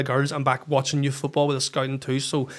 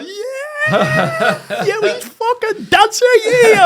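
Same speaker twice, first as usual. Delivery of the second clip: very high, loud celebration; jubilant yell; 11 dB louder, much slower than usual. Retold or imitated. Sent-off coach alone in the stand at late winner, jubilant yell. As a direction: whoop it out, laughing.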